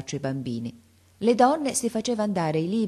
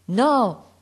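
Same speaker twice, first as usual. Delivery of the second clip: rifiuto gridato; 6 semitones higher, 7 dB louder than usual. A narrator's reading. Stardi rifiuta ancora.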